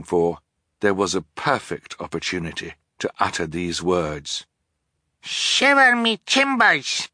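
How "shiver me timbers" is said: high, drawn-out little pirate cry; amazed cry. A narrator's reading. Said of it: "Sonny cried".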